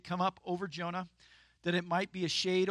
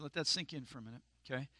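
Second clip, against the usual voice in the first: usual speaking voice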